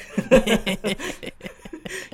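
high, giggly burst of laughter; real high-pitched laughing fit; cracking up. Real, not acted. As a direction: laugh hard at your own joke. Laughing at the Huerter joke.

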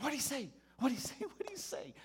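high, pressing, repeated question; eager church ladies. Retold or imitated. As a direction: crowd in eagerly to hear the news.

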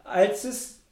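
high, exaggerated mock recap; mocking sing-song recap. Shown as a demonstration. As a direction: lay it on thick, mocking the plot. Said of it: "war das auch sehr emotional für sie".

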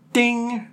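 high clear ding; imitated bell ding. Recalling the ghost's answer, high bell-like ding. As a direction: ring it like a bell. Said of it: "clear as a bell".

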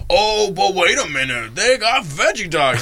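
high, drawn-out mock voice of a friend; Jizzy J. Podcaster imitating friend announcing veggie dogs, hammed-up impression. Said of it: "sounds like, friend".

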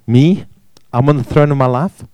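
usual speaking voice

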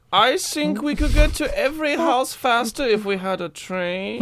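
high mock German accent; German Blitzen. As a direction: offer a suggestion as a German reindeer. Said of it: "what do you think, Blitzen?".

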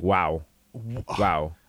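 low, soft wow in awe; stunned, breathless wow; hushed awe. Retold or imitated. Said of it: "everyone's like".

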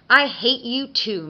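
Mister Kink's retort. A narrator's reading. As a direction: throw the hatred straight back. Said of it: "Mister Kink replied".

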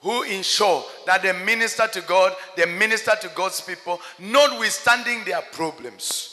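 earnest prayer leader calling on God; preacher leading a church prayer, fervent. Acted. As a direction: pray aloud over the congregation with urgency. Leads the congregation in prayer.

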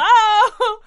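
huge high-pitched hammed-up hello; comically loud, drawn-out mock excitement; over-excited greeting. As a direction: belt out a gleeful, overblown greeting. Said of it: "it's not quite that excited".

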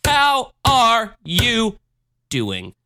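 slow, high drawn-out announcer question; a mock grand announcement of the question; host announcer. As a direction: stretch it out like a game show host. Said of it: "my legendary question".

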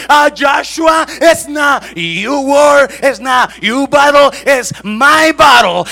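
high, shouted sermon delivery; full-force preaching. Acted. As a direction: thunder out God's reassurance to an embattled believer. (prédica a gritos, con voz aguda y potente)

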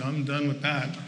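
low, soft, trailing mumble; deflated giving up. Retold or imitated. Preacher mimicking himself quitting after a try, deflated and quiet.

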